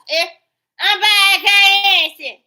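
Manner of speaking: high, loud mock voice of her; loud mocking imitation. Mocking the woman's claim of innocence, loud high parody. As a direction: parrot her like a taunt.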